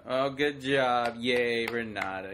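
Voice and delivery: slow, drawn-out half-hearted praise; weary mock congratulations, dragging each word; imitating humouring parents. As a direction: humour the kid without enthusiasm.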